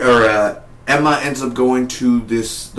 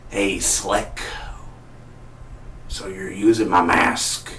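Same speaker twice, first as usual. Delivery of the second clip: a lightly voiced smoker's drawl, slightly lower and slow; Brandon James as a smoker; 3 semitones lower, 4 dB quieter, slower than usual. Brandon James phoning the jailed copycat, a hint of smoker's voice. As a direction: sound like an old chain smoker taunting. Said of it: "that sounded like a smoker".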